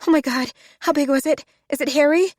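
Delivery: anxious squeal, dreading the answer; frightened. Acted. Rosie frightened about the spider.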